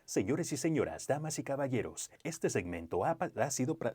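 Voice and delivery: high, booming Spanish sponsor announcement; lucha libre announcer. Pretend. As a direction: announce it like a ring MC. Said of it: "Close, close it out in Spanish if you can".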